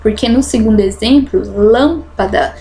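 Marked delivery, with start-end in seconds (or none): 1.5-2.5 "lâmpada" with a long, strong LÂM; drawn-out first syllable in "lâmpada"; stress on LÂM. Shown as a demonstration. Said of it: "minha sílaba forte".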